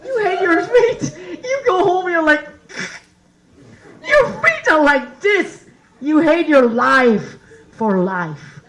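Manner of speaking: high, loud comic outburst; mock rant. Quoting a rant about ruined feet, mock outraged. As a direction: push it out high and slow.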